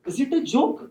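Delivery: lightly voiced snooty rival lawyer; mimicking Rajpal. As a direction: parrot his dismissive question. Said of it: "और हमारे राजपाल साहब कहते हैं".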